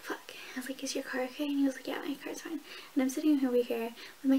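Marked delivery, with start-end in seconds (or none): 0.0-0.3 hushed, breathy curse under the breath; whispered fuck. Retold or imitated. Whispered reaction to spill. 0.8-1.5 whispered question. Retold or imitated. Retold timid question to date. 1.9-2.6 whispered reply. Retold or imitated. Imitating date's reply.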